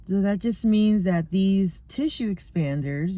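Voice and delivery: normal talking voice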